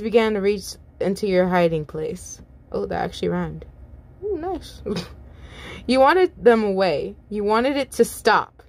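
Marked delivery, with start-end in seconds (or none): none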